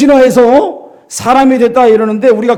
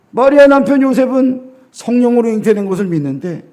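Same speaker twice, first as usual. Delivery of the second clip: ordinary talk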